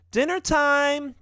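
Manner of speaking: high, drawn-out calling voice; wife's shout. A narrator's reading. Wife calling family to dinner, high shout. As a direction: sing out a summons, pitched very high. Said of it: "Geron's wife shouts".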